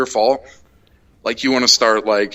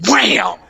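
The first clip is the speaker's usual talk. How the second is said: mock punch sound; 12 semitones higher, 3 dB louder than usual. Imitating a punch.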